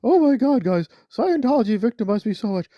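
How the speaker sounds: high, whiny mock sob voice; mock whining. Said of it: "when you're crying and whining, say".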